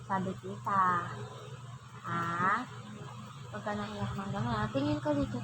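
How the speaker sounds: soft, low coaxing. Real, not acted. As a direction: hush a little one gently, keeping it quiet. Hushing a child not to cry.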